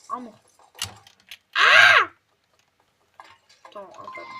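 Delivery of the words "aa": high, loud startled cry; startled yell. Real, not acted. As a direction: yelp as the jump goes wrong. Startled yell during jump.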